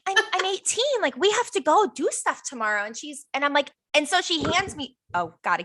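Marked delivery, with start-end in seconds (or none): 0.1-2.9 high, stammered mock protest; retold worried protest. Eighteen-year-old offered a shot, retold worried protest. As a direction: stumble at the start, pitched up in protest.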